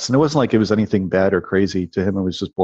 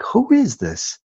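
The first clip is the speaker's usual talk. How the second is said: baffled question; 13 semitones higher, much slower than usual. Retold or imitated. Confused boy alone in hallway.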